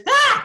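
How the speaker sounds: high, loud startled yell; sudden scream. Retold or imitated. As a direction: shriek as she leaps out. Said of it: "she just goes".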